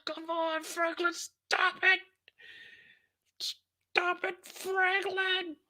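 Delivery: high pleading falsetto; whimpering beg in falsetto; overacted anime dub. Begging torturer to stop.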